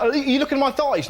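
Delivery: mock-indignant high question; accusing mum. Mum in the hot tub to her lazy-eyed son, mock accusing.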